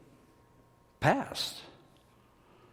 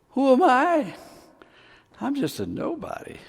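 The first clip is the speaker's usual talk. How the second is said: incredulous Moses; 9 semitones higher than usual. Acted. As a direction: echo Moses in disbelief. Preacher mimics Moses' doubt.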